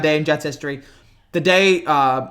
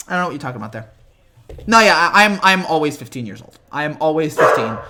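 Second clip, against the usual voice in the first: normal talking voice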